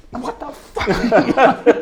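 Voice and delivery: drawn out and very slow, laughing through it; mock outraged shout. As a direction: imitate the bandleader's stunned outburst. Bandleader reacts mid-solo.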